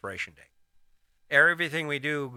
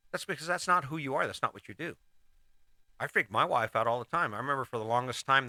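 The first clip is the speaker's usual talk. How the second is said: ordinary talk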